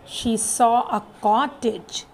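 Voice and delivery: ordinary delivery, nothing marked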